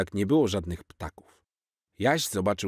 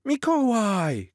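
put-on high, slow thrilled child cry; excited shout; 4 dB louder, much slower than usual. Little Jaś spotting Santa, high excited shout. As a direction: call out to Santa in delight. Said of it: "krzyknął Jaś".

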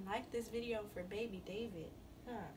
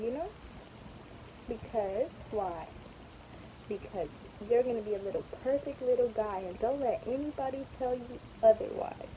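louder, earnest auntie pep talk; loud doting lecture; 4 semitones higher, 10 dB louder than usual. Real, not acted.

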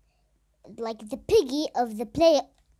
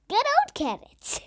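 giggly whisper; 10 semitones higher than usual. Real, not acted. Laughing whisper about carrots.

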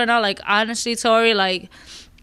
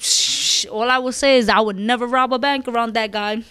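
usual speaking voice

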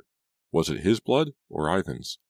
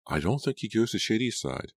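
put-on higher, different teen voice; bystander girl; 6 semitones higher than usual. A narrator's reading. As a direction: answer as a classmate. Another bystander answers.

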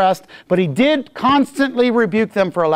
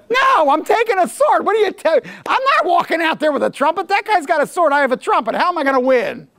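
much higher, indignant protesting voice; smart soldier refusing; 8 semitones higher than usual. Retold or imitated. Preacher mocking the smart soldier who refuses, high and indignant. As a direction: balk at walking out with a trumpet. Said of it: "They go".

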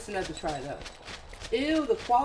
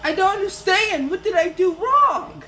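loud, high shout of disbelief; frustrated shouting; 12 semitones higher, 5 dB louder than usual. Real, not acted. After dying despite following the instructions, high frustrated shouting. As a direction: yell it at the game, baffled and fed up.

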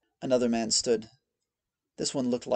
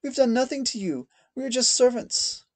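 high, frightened maid's voice; a terrified maid begging her captor; crying plea; 12 semitones higher, 5 dB louder than usual. A narrator's reading. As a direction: plead in tears for mercy. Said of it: "cried one of the maids".

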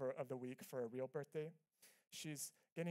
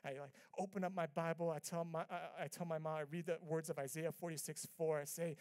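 ordinary delivery

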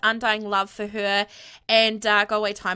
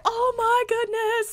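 ordinary talk, nothing marked

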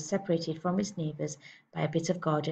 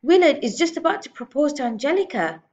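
high, loud wail of protest; raised, agitated complaint; crying out; 9 semitones higher, 8 dB louder than usual. A narrator's reading. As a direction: protest at missing the programme. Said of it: "Missus Wormwood cried out".

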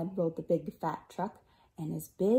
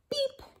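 short, high-pitched beep; quick high toot of a small truck. Acted. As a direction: squeak out a little horn beep. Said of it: "Beep," said Blue".

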